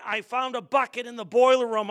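usual speaking voice

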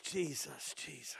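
hushed, low, fading plea; calling on Jesus. Retold or imitated. Preacher reliving his pain on the toilet, faint prayer. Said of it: "I'm just calling on Jesus".

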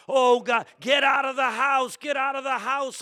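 high, loud retold yell of alarm; screaming and yelling. Preacher reliving a house fire, screaming warning. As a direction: act out yelling a panicked warning through the house. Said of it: "I'm screaming, yelling".

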